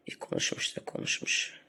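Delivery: snide whisper of İyi konuşmuş da konuşmuş; mock whisper. Sneering at a rival vlogger, whispered. Mocking a rival's endless talk.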